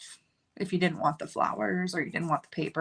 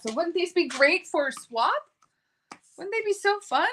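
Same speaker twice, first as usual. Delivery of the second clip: rising higher into a delighted squeal; excited gushing; 10 semitones higher than usual. Real, not acted. Crafter gushing to viewers about swap gifts, excited rising squeal. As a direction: sell the swap idea with building glee. Gushing over stamps for swaps.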